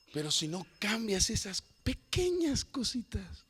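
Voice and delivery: slow whispered warning; gentle whisper. Retold or imitated. God speaks gently, warning.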